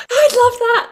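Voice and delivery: high, delighted gasp before the words; thrilled, can't hold it in; excited gasp. Real, not acted. Thrilled at singing lesson offer.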